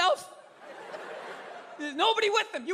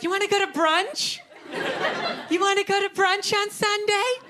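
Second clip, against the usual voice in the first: put-on higher, sing-song girlfriend asking about brunch; a light take on a girlfriend pitching Sunday brunch; 4 semitones higher than usual. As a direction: ask it brightly like an eager girlfriend. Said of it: "they'll be like".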